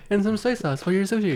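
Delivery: high, soft hostess voice; put-on female voice. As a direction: put on a high, softened server act. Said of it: "that's not her real voice at all".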